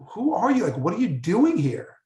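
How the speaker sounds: high, astonished "who are you"; startled. Retold or imitated. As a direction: jump up in pitch, caught off guard. Scientists startled by a stranger landing.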